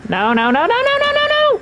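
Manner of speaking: rising to a high frantic shriek; panicked screaming. Real, not acted. Caught again.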